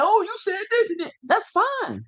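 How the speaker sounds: high, mocking voice of an accuser; mocking a critic. Vlogger mimicking a commenter's accusation in a high, mocking voice. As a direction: whine it back at them like a nagging commenter. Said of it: "So coming over here and be like".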